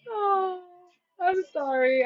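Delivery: high-pitched apology after real laughter; laughing apology. Real, not acted. Reader sorry for laughing at the reading, still amused. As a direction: apologize while recovering from giggles. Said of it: "I shouldn't be laughing".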